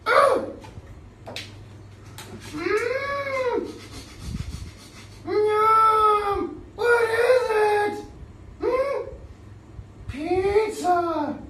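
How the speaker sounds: very high, loud squeaky cheers; excited puppet. Acted. Puppet Boo Boo delighted by the pizza card, high squeaky cheers.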